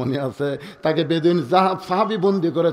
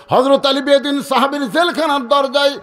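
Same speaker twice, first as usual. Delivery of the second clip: high, raised call to a prisoner; dramatic high-pitched sermon delivery; preaching; 9 semitones higher, 4 dB louder than usual. Acted. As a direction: call out the question from the pulpit. Preacher acts Ali calling at jail.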